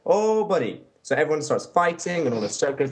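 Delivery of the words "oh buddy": high mocking oh buddy; mock whine. Friend mocking the drunk guy in a high whine. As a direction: tease him in a high whiny voice. Mocking reaction in retold fight.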